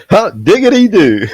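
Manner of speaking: loud high hollered country whoop; hot diggity whoop. Real, not acted. Friend whoops loud and high at the voice box joke. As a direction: holler it out high like a rodeo cheer.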